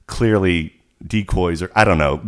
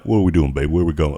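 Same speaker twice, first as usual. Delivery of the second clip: lightly imitated smooth seducer; a hint of a soul crooner's come-on; 4 semitones lower, faster than usual. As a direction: slip into a mock seductive man voice.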